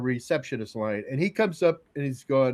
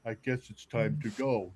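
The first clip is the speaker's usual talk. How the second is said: low, soft retreat; acted-out meek reply; imitating subdued dad; 5 semitones lower, 9 dB quieter than usual. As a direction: back down quietly. Voices rebuked dad backing off.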